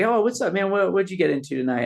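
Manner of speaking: bright, high friendly greeting; chirpy clerk saying hi; gas station clerk. Retold or imitated. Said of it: "and he's like".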